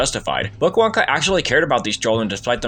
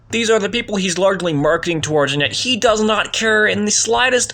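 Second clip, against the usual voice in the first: indignant rant; 7 semitones higher than usual. Real, not acted. Rant at Wonka's indifference.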